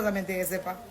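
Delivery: ordinary talk, nothing marked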